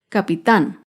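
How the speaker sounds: low, firm "Capitán", ca-pi-TÁN; stress on last syllable. Shown as a demonstration. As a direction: hit the final TÁN of "Capitán". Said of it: "Se acentúa en la última sílaba".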